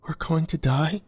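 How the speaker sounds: high, frightened squeak. A narrator's reading. Imogen squeaks in terror at her captors, high and frightened. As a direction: squeak it out in fear. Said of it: "Imogen squeaked".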